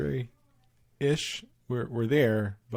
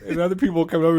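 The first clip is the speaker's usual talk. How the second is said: usual speaking voice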